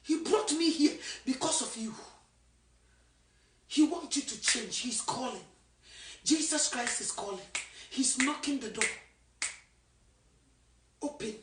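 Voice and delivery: soft, tender fading invitation; quiet altar call. Acted. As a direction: draw them in gently.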